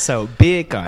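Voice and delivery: beer can said like Jamaican bacon; Jamaican accent. Shown as a demonstration. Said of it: "Jamaican accent".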